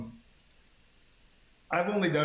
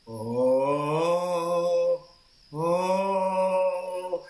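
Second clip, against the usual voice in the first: long, sustained mock-stoned "ohhh"; dazed drawn-out "oh"; 2 semitones higher, 3 dB louder than usual. Imitating the salvia guy who just freezes, dazed drawn-out oh. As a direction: imitate a tripping man's slow, stretched moan.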